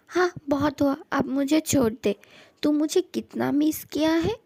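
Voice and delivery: normal talking voice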